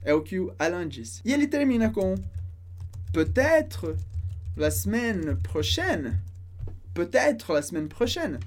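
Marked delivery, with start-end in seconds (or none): 3.1-6.2 slow French, broken into parts; slow model phrase. Shown as a demonstration. Teacher models the dialogue's last line, slowly in parts. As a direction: space it out carefully for learners.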